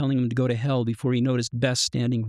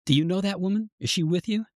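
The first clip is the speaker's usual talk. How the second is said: sharp, much higher angry questions; furious; 7 semitones higher than usual. A narrator's reading. Ex-wife confronts him outside the gallery, furious and high. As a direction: she demands answers from her ex-husband, furious. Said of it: "she said, furious".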